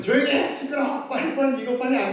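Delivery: high, sharp mock yelling; scolding mother. Pastor imitating an irritated mother yelling at her son. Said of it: "이게 아니라".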